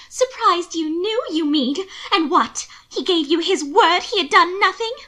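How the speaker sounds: high, loud, scornful questions; incredulous. A narrator's reading. Sister disputes the husband's word to her brother, loudly incredulous.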